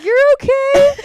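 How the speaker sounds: high, loud imitation of his reply; hammed-up high shout; mock alarmed reassurance. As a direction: squeal it out, mimicking him. Acts his reaction to hard grip.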